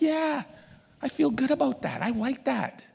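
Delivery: high-pitched, pleased acted voice; delighted. Someone just praised for good behaviour, acted delight.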